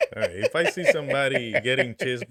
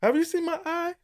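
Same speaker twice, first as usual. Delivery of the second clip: whiny high voice; 12 semitones higher, 5 dB louder than usual. Pretend. Mock whiny eye complaint.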